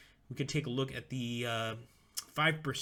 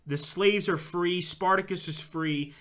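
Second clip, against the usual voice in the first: normal talking voice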